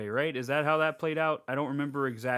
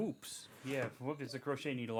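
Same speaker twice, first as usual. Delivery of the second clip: much softer, pulled-back correction on "crochet needle"; quiet; 15 dB quieter than usual. Real, not acted. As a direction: drop to a hushed, sheepish voice. Apologizes after getting too excited.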